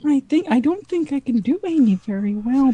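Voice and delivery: usual speaking voice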